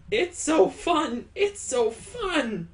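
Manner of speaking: high, drawn-out squeal of delight; delighted, high-pitched cutesy voice; gleeful. Acted. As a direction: sing out your joy, high and slow. Gleeful girl joining the kisses.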